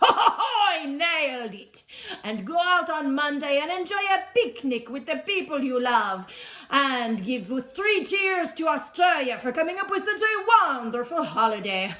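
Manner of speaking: put-on theatrical French-accented dinosaur presenter; hammed-up French accent, lively and declaiming. Dinosaur explaining Picnic Day to listeners, jolly French showman.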